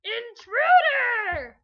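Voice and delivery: loud, high, slow drawn-out yell; slow alarmed shout; screaming alarm. Acted. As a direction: sound the alarm at the stranger. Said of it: "Grr looks down and screams".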